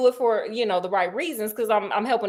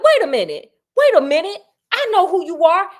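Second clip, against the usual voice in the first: higher and louder, catching on all at once; sudden alarmed realization; 6 semitones higher, 7 dB louder than usual. Retold or imitated. Blackmailer recognizing the veiled lady, alarmed and louder. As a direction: snap to attention, wait a minute, I know you. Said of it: "Charles in charge is like".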